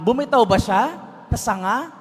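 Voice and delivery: ordinary delivery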